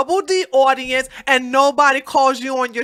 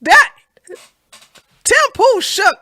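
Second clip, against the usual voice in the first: high giddy laughing gloat; excited laughing; 12 semitones higher, 4 dB louder than usual. Real, not acted. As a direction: cackle with glee at the screen. Gloating at Tim Pool shaking.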